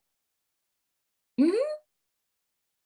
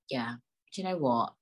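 usual speaking voice

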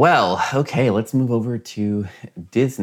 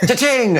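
even higher, louder cha-ching; cash register ka-ching; 5 dB louder than usual. Pretend. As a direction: belt out the register sound. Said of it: "a little sound effect whenever Disney prices".

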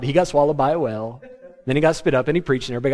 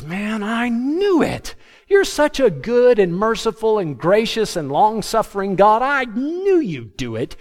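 much higher, slow whiny huff; huffy complaint; 7 semitones higher than usual. Retold or imitated. Jonah sulking at God over mercy on Nineveh, high slow whine. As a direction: sulk at God like a pouting prophet. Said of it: "Jonah's in a huff".

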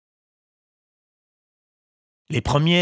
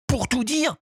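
high puppet voice; a wooden boy choosing words; 13 semitones higher than usual. A narrator's reading. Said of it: "fit remarquer Pinocchio". (voix de pantin aiguë)

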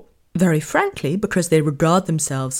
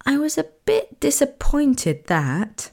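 higher and slower, overly polite British; mock polite understatement; 7 semitones higher, slower than usual. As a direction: say it sweetly, as if it barely matters.

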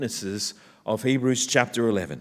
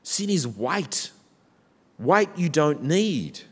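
normal talking voice

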